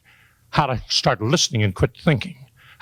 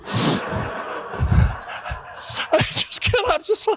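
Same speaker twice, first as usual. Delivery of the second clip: high, squeaky mock breakdown; mock hurt; 3 dB quieter, much slower than usual. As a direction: pretend to choke up in a tiny high voice. Mock hurt over a rejected card.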